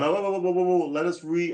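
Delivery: high, loud, flustered backtracking; mock alarm. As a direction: imitate someone hastily putting on the brakes. Imitating church leaders' alarmed backtracking.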